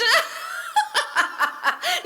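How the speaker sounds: high shrieking witch cackle. A narrator's reading. Wicked witches laughing at the stranger again, shrill cackle.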